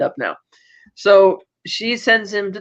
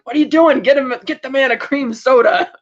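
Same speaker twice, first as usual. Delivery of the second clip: flustered manager; 6 semitones higher, 3 dB louder than usual. Retold or imitated. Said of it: "the bank manager's like".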